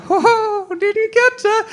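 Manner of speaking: very high falsetto cartoon mouse; chummy squeaky puppet asking for a repeat; Mickey Mouse. Puppeteer dad who can't hear his son, Mickey Mouse voice. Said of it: "as Mickey".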